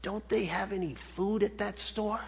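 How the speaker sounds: a dying, barely-there murmur; very faintly. Acted. Dying parrot's final words to its owner, very faint. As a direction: give it with the last breath. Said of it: "it said very faintly".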